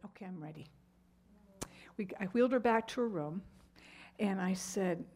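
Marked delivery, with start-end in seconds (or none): 0.1-0.7 soft, much quieter acceptance; a mother softly giving in; quiet resigned. Retold or imitated. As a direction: let the words barely come out. Mother quietly resolves after silence.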